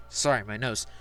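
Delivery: ordinary delivery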